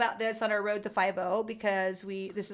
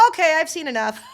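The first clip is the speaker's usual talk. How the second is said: lightly voiced dad, pitched up; co-host's dad; 6 semitones higher, 4 dB louder than usual. Host retelling dad walking out, mock dad voice. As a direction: imitate a dad announcing he's done. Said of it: "And then he got up".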